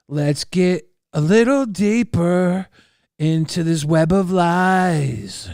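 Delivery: slow, drawn-out teaser; mock-dramatic intro. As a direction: stretch it out like a mystery host. Mock-dramatic lead-in to next topic.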